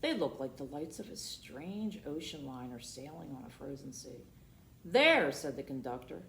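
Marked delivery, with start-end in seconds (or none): 4.8-5.3 high, loud exclamation; pointing call. Acted. Conductor showing children the Pole, loud call. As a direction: point it out with a cry.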